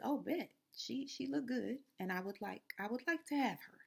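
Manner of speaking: a lightly voiced greedy king; Pharaoh's leering voice. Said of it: "And then Pharaoh says".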